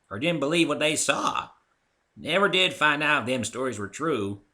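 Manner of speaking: put-on an old mare spinning a tale; old granny.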